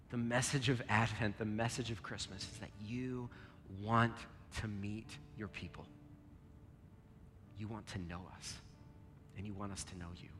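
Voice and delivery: earnest, measured prayer voice; pastor's heartfelt prayer; closing prayer. Acted. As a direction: pray over the congregation.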